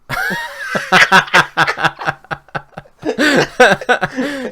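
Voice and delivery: high, loud burst of laughter; long helpless fit of laughing. Real, not acted. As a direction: crack up loudly. Date story sets off laughter.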